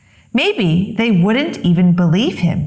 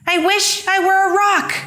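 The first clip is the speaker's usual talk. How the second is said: put-on much higher, frightened kid's blurt; panicked wish; 11 semitones higher than usual. A narrator's reading. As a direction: blurt it out in panic before the lion. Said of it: "but he panicked".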